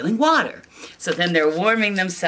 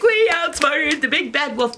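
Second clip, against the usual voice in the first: higher, louder pig cheer; triumphant pigs; 9 semitones higher, 4 dB louder than usual. Acted. Pigs celebrating their trick, loud high cheer. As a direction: crow about beating the wolf. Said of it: "the three pigs are like".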